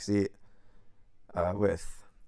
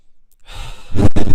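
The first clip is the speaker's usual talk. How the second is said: big, loud blown-out sigh; loud huff of resignation; exasperated sigh; 19 dB louder than usual. Retold or imitated. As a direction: let out a heavy breath in dismay. Acts out his exasperated reaction.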